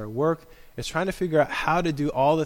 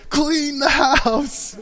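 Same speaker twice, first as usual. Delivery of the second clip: angry dad; 12 semitones higher, 6 dB louder, much slower than usual. Retold or imitated. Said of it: "and he would say".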